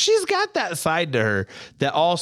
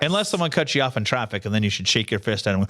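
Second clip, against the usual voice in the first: ordinary talk, nothing marked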